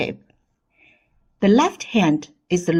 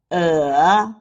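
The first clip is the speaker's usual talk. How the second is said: "uh" dipping, then climbing; falling-rising tone; 2 semitones lower than usual. Shown as a demonstration. Teacher leads repeat drill, dipping uh. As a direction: sink then lift a long "uh". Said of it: "The third tone is the falling rising tone".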